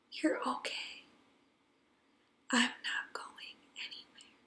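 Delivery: very soft whisper with long pauses; whispered reassurance. Retold or imitated. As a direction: lull a scared toddler, whispering slowly between silences. Retold soothing repeated in the crib.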